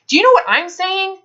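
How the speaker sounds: ordinary talk, nothing marked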